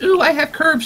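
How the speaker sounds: high-pitched mocking girly coo; mock coy boast. Streamer mocks a cheese-eating guest, high coy imitation.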